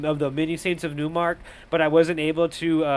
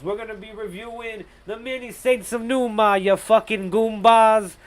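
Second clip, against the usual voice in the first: hammed-up Italian-American New York accent; tough-guy New York mob accent, stretched out; mock New York mobster accent; 5 semitones higher than usual. Mock Sopranos mobster introducing film.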